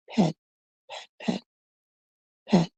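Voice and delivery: soft breathy whisper while patting; whispered, tender pats; whispered pets. Acted. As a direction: hush it like petting a pet. Petting the toy.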